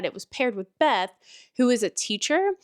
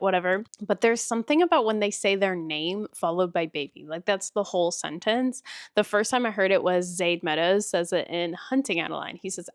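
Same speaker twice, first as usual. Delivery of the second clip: usual speaking voice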